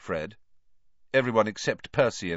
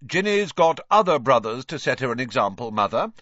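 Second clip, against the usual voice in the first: superior, louder reply; lofty; 5 semitones higher, 7 dB louder than usual. A narrator's reading. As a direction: look down on them as you say it. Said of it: "said Percy loftily".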